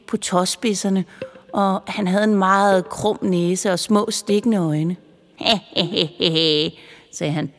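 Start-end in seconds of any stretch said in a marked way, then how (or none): 5.4-6.7 slow sly elf snicker; a mischievous little cackle; creepy giggle. A narrator's reading. The eerie elf giggles at his own tricks. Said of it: "Han så lidt uhyggelig ud".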